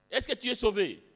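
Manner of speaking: loud, abrupt question; blunt challenge. Retold or imitated. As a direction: fire the question at him like a challenge. Evangelist accosts worker on site. (interpellation brusque et forte)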